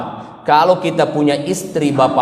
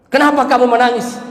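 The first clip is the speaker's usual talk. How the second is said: husband asking; 9 semitones higher than usual. Acted. Husband asking his crying wife, voice raised high.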